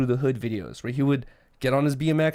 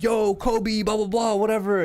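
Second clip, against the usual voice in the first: imitating a heckler; 6 semitones higher than usual. Retold heckler taunting Kobe statue.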